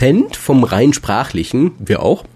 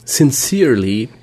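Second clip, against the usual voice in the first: ordinary delivery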